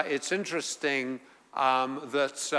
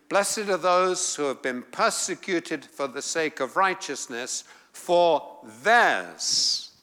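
theirs hit hard and louder at the end; emphatic stress on theirs; 3 dB louder than usual. Shown as a demonstration. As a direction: push theirs out with force.

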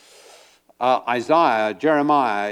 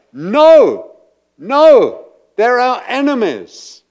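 loud, high, scornful no; emphatic refusal; 10 semitones higher, 12 dB louder, slower than usual. Acted. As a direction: spit out the refusal.

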